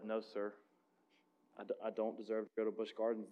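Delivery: put-on quiet tearful boy. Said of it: "he kind of quietly sniffled a little bit".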